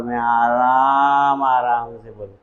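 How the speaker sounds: very slow, low, dragged-out words; slow motion. Shown as a demonstration. Teacher mocking slow motion for students. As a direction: stretch each word like a slowed-down video. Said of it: "वो slow motion वाला button दबा दो".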